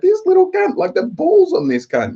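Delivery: high-pitched, incredulous retelling; shocked disbelief. Retold or imitated. Man comes inside to report the kid's nerve, amazed. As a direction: marvel at the nerve of it, pitch leaping up. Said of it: "I was shocked".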